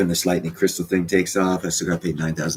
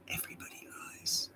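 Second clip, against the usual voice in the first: whispered secret; 16 dB quieter than usual. Acted. Streamer confiding a secret to viewers in a whisper. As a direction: whisper it, barely audible.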